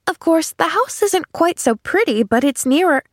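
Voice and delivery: put-on high, lilting child's voice; reasonable little girl. A narrator's reading. Girl comparing the houses, high and earnest.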